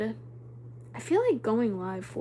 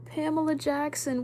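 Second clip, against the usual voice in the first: ordinary talk, nothing marked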